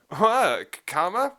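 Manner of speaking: sharp startled cry, high and loud. Acted. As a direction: jolt awake in alarm. Waking from a nightmare, shocked.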